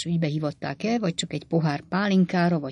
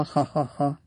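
small put-on laugh trailing a request; acted chuckle; 2 semitones lower, 5 dB quieter than usual. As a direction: chuckle quietly, as if half-hearted. Character laughs after asking for money.

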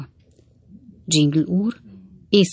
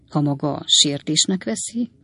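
usual speaking voice

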